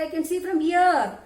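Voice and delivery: a hint of a proud owl calling out; boasting owl. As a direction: raise your voice as a boasting owl. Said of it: "So owl is saying".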